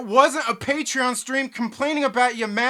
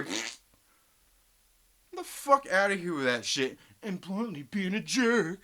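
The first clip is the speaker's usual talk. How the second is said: ordinary talk, nothing marked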